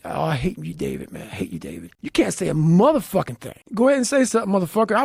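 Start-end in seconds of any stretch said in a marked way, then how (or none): 0.0-1.9 low, muttered self-hatred at the mirror; quiet self-loathing. Retold or imitated. Talking to himself in the mirror, low and soft. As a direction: hiss it at yourself, much lower and softer.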